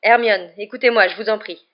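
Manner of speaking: loud plea, easing off; urgent exclamation. A narrator's reading. Professor begging his accusing pupil to listen, exclaiming. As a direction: call out to be heard, pleading. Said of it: "s'exclama Lupin".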